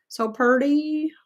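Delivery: "So pretty" drawn out in a high, slow Southern accent. Shown as a demonstration. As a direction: stretch "So pretty" out long and high, Southern style. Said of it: "I should do a Southern accent".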